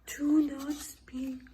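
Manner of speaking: slow hushed whisper. Acted. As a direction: whisper it slowly. Dramatic hushing.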